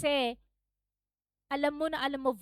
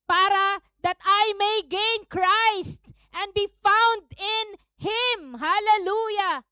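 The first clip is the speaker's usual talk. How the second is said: high, loud, drawn-out scripture declaration; raised sermon voice; preaching; 7 semitones higher, 7 dB louder, much slower than usual. Acted. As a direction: proclaim it slowly to the congregation.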